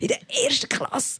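very high-pitched mock outrage; imitated scolding. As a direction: mimic an old woman's scandalised squawk. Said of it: "wie sie mich anwäffelt".

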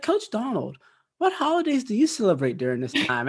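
high-pitched imitation of a little kid. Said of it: "This little kid asked me".

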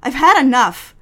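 loud, slow defiant yell; defiant shout. Acted. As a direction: throw it back at him, fed up. Secretary defies boss in quarrel.

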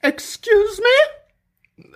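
very high mock-weepy wife. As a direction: squeal it like an offended lady, half-crying. Wife rebuffs king, voiced.

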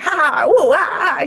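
mock kung-fu yelps; playful fight cries; hammed-up martial arts noises; fighting grunts. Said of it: "he was like".

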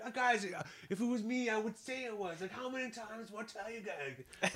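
hammed-up honest guy pleading; self-righteous self.

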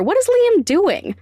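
usual speaking voice

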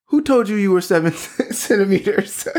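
high-pitched incredulous quote, breaking into laughter; mock disbelief. Nurse questions the patient's dilation, imitated disbelief with a laugh. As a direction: imitate someone utterly incredulous, amused. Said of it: "in, like, the biggest disbelief".

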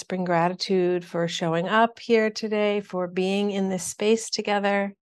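calm, even meditation guidance; guided meditation. Acted. Shaman guiding an online group through breathing, calm meditation voice.

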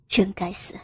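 understated low-pitched self-reproach; man cursing inwardly. A narrator's reading. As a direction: drop down for a muttered male curse. Male lead curses to himself.

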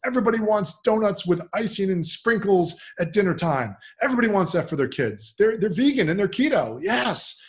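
high, hammed-up sarcastic praise; mock enthusiasm. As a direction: rave as if the silly idea were brilliant.

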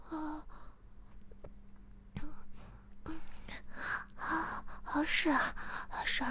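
high, straining whispered moans and pleas; strained. Acted. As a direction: squeeze the words out as if struggling. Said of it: "诗雅辛苦地说".

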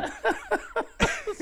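high burst of laughter. Real, not acted. Laughing at the memory of his friend's eyes. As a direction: crack up laughing.

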